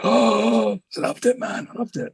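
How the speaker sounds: high, airy gush of approval; gushing colleague. Retold or imitated. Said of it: "Seb was just like".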